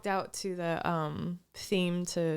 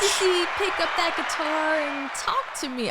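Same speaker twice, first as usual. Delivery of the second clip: excited rock callout; 7 semitones higher, 5 dB louder than usual. Acted. Calls out Poison lyric.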